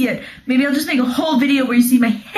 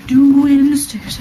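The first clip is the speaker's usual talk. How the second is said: louder, drawn-out announcement; 3 semitones higher, 7 dB louder, slower than usual. Real, not acted. Showing viewers around the house, loudly announcing the stairs. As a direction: announce it like a big event, stretching it out. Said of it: "We're doing the stairs".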